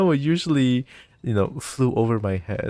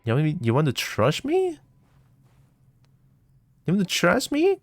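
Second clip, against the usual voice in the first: mock outrage; 5 semitones higher than usual. Real, not acted. Mishears threat, mocks it.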